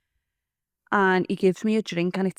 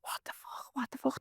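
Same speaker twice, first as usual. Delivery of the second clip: breathless, hushed disbelief; retold panic as a stunned whisper; shocked whisper; 8 semitones higher, 12 dB quieter than usual. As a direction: whisper it in horror, no voice. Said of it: "I started screaming".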